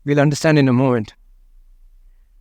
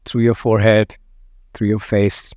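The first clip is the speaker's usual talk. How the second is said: guided meditation; 3 semitones lower than usual. Acted. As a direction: let each phrase settle before the next. Guides a body scan.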